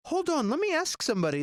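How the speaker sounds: high-pitched puzzled reply; imitated puzzled staff. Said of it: "they were very puzzled".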